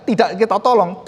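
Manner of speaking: ordinary talk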